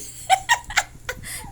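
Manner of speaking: high, loud laugh; amused laughter. Real, not acted. Owner amused by the eldest dog, laughing out loud. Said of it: "Natatawa ako sa iyong panganay".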